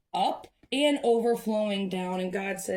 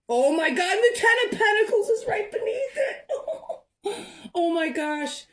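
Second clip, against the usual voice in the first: much higher and louder, laughing and near tears; overjoyed; 13 semitones higher, 4 dB louder than usual. Real, not acted. Reader thrilled as Ten of Pentacles appears, laughing near tears. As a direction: burst out thrilled, half laughing half crying.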